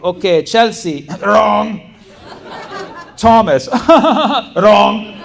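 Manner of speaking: loud, high mock announcement of wrong answers; stern professor. Professor shaming students in front of class, loud mock verdicts. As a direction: call out names like public shaming. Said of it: "So he was like".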